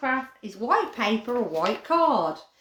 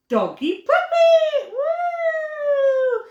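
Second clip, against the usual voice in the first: much higher and louder, slow and gleeful; excited cheer; 7 dB louder, much slower than usual. Real, not acted. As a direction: cheer the finished puppy. Presenting second craft.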